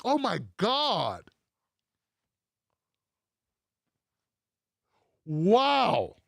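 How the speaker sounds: slow, drawn-out mock astonishment; mock amazement. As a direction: stretch it out in pretend shock.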